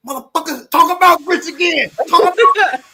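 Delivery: high, loud laughing mock jeer; passengers chiming in. Other riders piling on the grits guy, hammed-up jeer. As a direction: jeer at him through a laugh. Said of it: "other people started chiming in".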